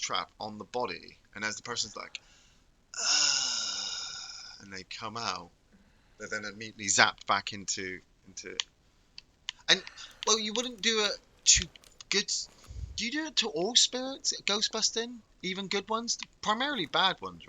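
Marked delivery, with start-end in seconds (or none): 3.0-4.6 dying groan. Retold or imitated. Imitates the dying person. 9.7-17.5 high playful questioning. Real, not acted. Musing over ghost-busting questions.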